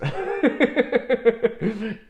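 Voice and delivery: real high giggling burst; a long, loud chuckle at your own remark; laugh. Real, not acted. Speaker jokes to viewers about loving an audience, high laugh.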